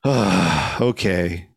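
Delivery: put-upon sigh and resigned okay; imitated unbothered reaction. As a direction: mimic a reluctant attendant sighing. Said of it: "reaction to it was like".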